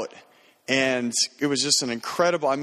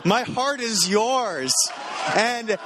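slow, much higher, swooning mock serenade; hammed-up love declaration; 7 semitones higher, much slower than usual. Reading the kid's text to Leah aloud, a swooning mock love declaration. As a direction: ham it up like a lovesick suitor.

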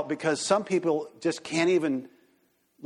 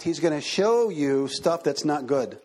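normal talking voice

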